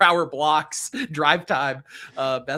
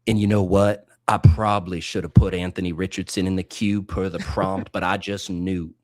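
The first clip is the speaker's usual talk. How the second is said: smooth jazz DJ; 8 semitones lower than usual. Acted. Announcing the pick to his cohost, deep low smooth jazz DJ voice. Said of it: "must talk like they're hosting a smooth jazz radio station".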